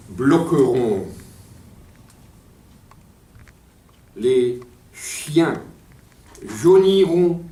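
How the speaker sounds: halting poetry declamation, long pauses between phrases; déclamation poétique. Acted. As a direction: declaim each fragment on its own, letting silence follow. Lit son poème à voix haute. (déclamation poétique, hachée de longs silences)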